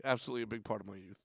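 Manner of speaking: usual speaking voice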